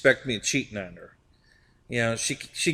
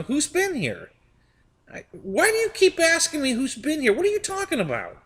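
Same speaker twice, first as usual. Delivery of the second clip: higher, fed-up mock protest; exasperated retelling; 13 semitones higher than usual. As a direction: imitate his own annoyed complaint to his girlfriend. Third time, exasperated retold reply.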